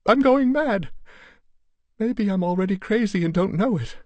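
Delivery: haunted wife describing her nightmare; terrified. Acted. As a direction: dread spilling into panic.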